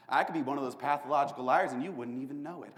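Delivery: ordinary talk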